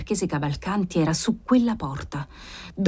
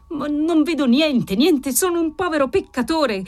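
desperate cry; 7 semitones higher than usual. A narrator's reading. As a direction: cry out in despair, slowing the first words. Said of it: "gridò disperatamente il marchese Cavalcanti". (grido disperato, acuto)

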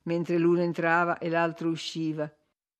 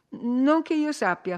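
higher-pitched, slow, evasive reply; flustered mumble; 7 semitones higher, 3 dB louder, slower than usual. A narrator's reading. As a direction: falter it out slowly, pitched up. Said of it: "farfugliò lei".

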